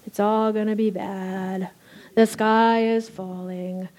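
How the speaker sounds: slow, droopy gloomy donkey; Eeyore voice. Acted. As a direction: moan it out like Eeyore. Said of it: "Winnie the Pooh and Eeyore".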